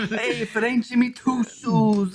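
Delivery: joking drawn-out New York greeting; New York accent. Pretend. Co-host greeting made-up Jimmy Toe Shoes, hammed-up New York accent. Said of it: "I fucking suck at the New York accent".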